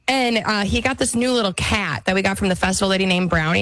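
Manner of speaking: ordinary delivery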